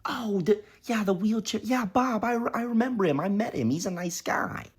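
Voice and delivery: high, sweet recollection; grandma recalling Bob, high and sweet; high old grandma voice. Retold or imitated. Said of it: "And she goes".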